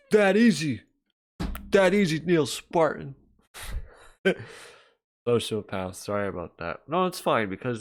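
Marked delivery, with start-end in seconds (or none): 0.1-3.1 high excited exclaim. Pretend. Mock exclaim at chat.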